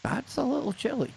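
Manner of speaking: high-pitched playful echo; mock understatement. Host echoing guest about minus twenty-one, playfully high-pitched. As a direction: lift it high for comic understatement.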